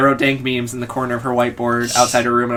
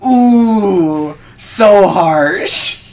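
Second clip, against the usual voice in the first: mock dismay; 5 semitones higher, 5 dB louder, much slower than usual. Real, not acted. Mock dismay at one zit.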